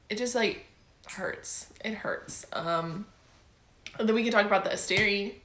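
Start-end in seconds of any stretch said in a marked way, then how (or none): 1.1-2.5 breathless, much softer, voiceless; whispered hurt. Real, not acted. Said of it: "heartbreak for character".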